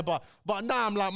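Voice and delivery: ordinary talk, nothing marked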